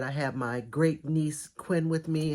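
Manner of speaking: normal talking voice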